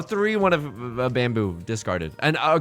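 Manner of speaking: normal talking voice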